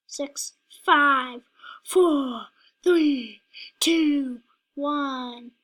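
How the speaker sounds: loud countdown, each number called out; shouted countdown. Real, not acted. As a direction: call the numbers out loud, one by one. Excited countdown in a game.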